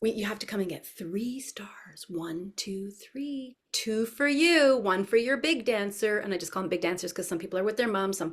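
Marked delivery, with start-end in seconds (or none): 0.0-3.6 soft, careful counting-along voice; hushed preschool teacher giving a simple task; talking to toddlers. Shown as a demonstration. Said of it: "I'm pretending that I'm talking to". 3.7-6.2 slow, high sing-song for toddlers; lilting preschool teacher; animated teacher voice. Shown as a demonstration. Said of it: "animated voice".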